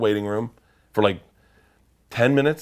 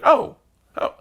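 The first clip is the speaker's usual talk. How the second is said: surprised oh. Retold or imitated. Surprised realization.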